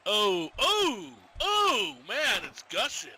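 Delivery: loud, rising cries of amazement; whooping, grossed-out excitement; shocked outburst. Real, not acted. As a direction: react loudly to a gory sight. Reacting to blood gushing in match.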